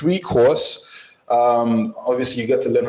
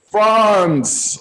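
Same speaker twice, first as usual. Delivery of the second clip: laughing; 9 semitones higher, 3 dB louder than usual. Real, not acted. Laughs while reading out France.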